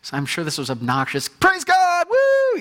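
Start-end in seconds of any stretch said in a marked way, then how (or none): 1.3-2.6 much higher, louder joyful shout; healed Samaritan shouting praise at Jesus' feet. Retold or imitated. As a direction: cheer wildly like an overjoyed man. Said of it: "praising God in a loud voice".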